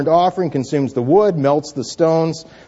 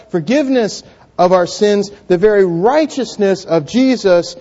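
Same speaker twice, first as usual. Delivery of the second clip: raised, emphatic gospel proclamation; impassioned preaching; 6 semitones higher, 4 dB louder than usual. Real, not acted. Preacher proclaiming God's grace on the cross, impassioned. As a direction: preach it high and forceful, phrase by phrase.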